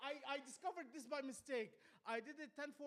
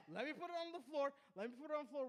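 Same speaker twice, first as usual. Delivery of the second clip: louder plea; 5 semitones higher, 7 dB louder, faster than usual. Retold or imitated. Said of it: "holding a cigar".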